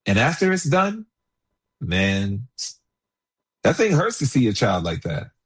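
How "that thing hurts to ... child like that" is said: ordinary delivery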